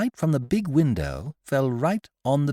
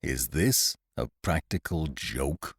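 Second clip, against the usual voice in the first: much lower, softer and slow, chilling; icy; 10 semitones lower, 6 dB quieter, slower than usual. A narrator's reading. The uncle confronts Bruce over the portrait, icy. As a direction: freeze the room with it. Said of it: "in a way that set about sixteen drafts cutting through".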